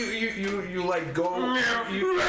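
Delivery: ordinary talk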